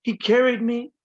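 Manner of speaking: emphatic poetry voice with pauses between images; poem reading. Acted. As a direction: recite in short, weighted phrases. Begins reading second poem.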